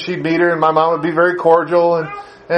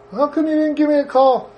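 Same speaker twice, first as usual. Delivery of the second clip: much higher, a lightly voiced young woman; the girl asking; 10 semitones higher than usual. The girl asking why he never called, higher voice. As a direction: ask it like a puzzled girlfriend. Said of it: "girl asking him".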